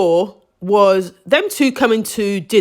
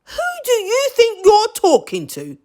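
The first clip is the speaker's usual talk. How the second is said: high, offended retort; indignant; 12 semitones higher than usual. Retold or imitated. Recalling her thought about the boyfriend, high offended retort. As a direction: put him in his place, pitched high. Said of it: "I thought".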